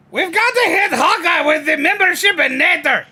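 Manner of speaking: a partial Doofus voice shouted to chat; Doofus voice attempt. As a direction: ham up the goofy Doofus voice, nearly yelling. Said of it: "I can at least get the voice partially there".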